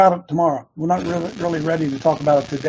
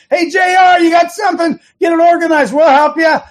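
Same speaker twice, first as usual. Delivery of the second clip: very high, loud rallying yell; shouting; 10 semitones higher, 7 dB louder than usual. Acted. Host yelling at J R to get organized. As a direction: shout it like a coach on the sideline.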